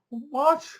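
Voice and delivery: high, rising disbelieving "what"; incredulous high-pitched question; baffled viewer. Retold or imitated. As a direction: squeak it in mock disbelief.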